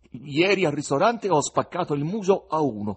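proud, full-voiced confession; manly confession. A narrator's reading. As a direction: own up to it boldly and forcefully. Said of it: "confessò virilmente il poeta".

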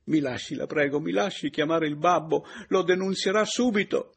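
high, loud plea, building; frantic insistence. Acted. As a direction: plead urgently, rising to a cry. Woman insists on calling her father. (supplica concitata, in crescendo)

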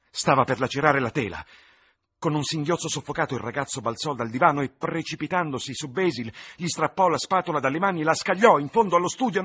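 rising, fast, dramatic narration; urgent narration. A narrator's reading. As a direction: build the tension urgently. Narration climaxes as Dorian lunges.

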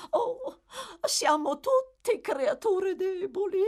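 very high, slow, plaintive lady; wistful matron. A narrator's reading. Mrs Corney stating a general principle, plaintive. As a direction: sigh it out in a high feminine voice.

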